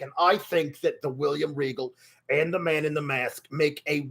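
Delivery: a rough, throaty persona voice held all through; throaty character voice. Pretend. Streamer greeting chat and commenting in his throaty character voice. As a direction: stay in the throaty character while chatting. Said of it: "it's a very throaty voice".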